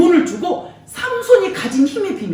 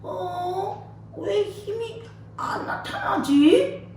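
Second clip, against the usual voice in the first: bewildered; 6 semitones higher, 6 dB quieter, much slower than usual. Acted. Samson finds strength gone.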